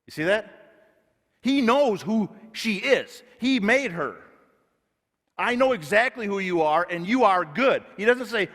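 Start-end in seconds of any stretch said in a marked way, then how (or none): 5.4-7.8 much higher and louder, fervent; preacher voicing God's loving words at full force; God's affirmation.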